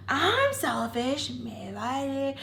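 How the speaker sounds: slow, drawn-out offended retort; high, dragged-out disbelief; indignant echo. Retold or imitated. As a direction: throw the accusation back, stretched out. Retold indignant reply to accusation.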